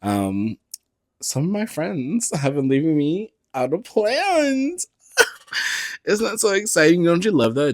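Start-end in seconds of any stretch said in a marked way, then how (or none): 1.2-7.7 sweetly mock the friends who left her out; sarcastic. Sarcastic about being left out.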